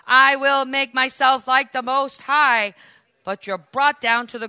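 loud, declaiming scripture read; fervent scripture reading. Acted. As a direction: proclaim the passage like a preacher. Reads Isaiah passage with force.

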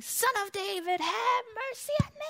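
high, strained calling out; acted desperate plea from afar; imitated persistent cry. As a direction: keep crying out, pitch climbing to the end. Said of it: "He kept continuing".

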